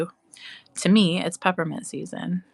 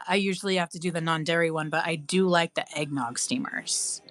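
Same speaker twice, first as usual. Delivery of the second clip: ordinary talk, nothing marked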